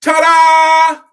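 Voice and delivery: high, very loud showman ta-da; ringmaster ta-da. Retold or imitated. Preacher acting out the acrobats for the congregation, big showy ta-da. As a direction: belt it out like a circus performer. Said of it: "gather and go".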